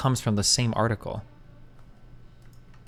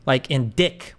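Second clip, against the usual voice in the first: ordinary talk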